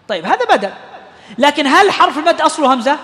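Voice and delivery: usual speaking voice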